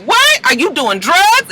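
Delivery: high, rising mock disbelief; shocked questioning. Blanca questions Angel about drugs, imitated shock. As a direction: act out an alarmed, shooting-up question.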